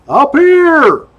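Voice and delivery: a high shout from up the hill; calling out. Retold or imitated. Stranded man up the hill, shouting high down to the trooper. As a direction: call down to the trooper from far above.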